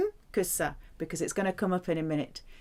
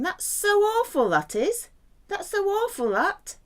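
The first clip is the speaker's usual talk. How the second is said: "so" stretched high in "That's so awful"; mock exaggerated emphasis; 12 semitones higher, 3 dB louder than usual. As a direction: ham up "so" in "That's so awful" like gossip. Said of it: "It's like us saying".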